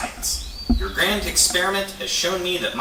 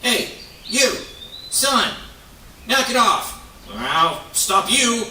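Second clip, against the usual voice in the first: angry yelling; 4 semitones higher, slower than usual. Acted. Beaver scolds the sun for stealing lake water, angry yell. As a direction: shout the sun down in protest.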